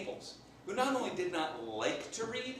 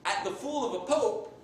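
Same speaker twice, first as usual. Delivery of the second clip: gloating; 6 semitones higher, 7 dB louder than usual. Acted. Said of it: "he keeps laughing for a little bit".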